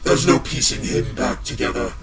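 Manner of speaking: ominous low voice. Acted. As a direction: pronounce his doom softly. Villain's low ominous verdict.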